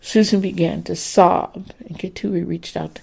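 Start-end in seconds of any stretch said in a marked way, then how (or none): none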